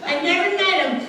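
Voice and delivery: a hint of a baffled old lady retelling; old lady coworker. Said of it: "then she'd tell me in the break room".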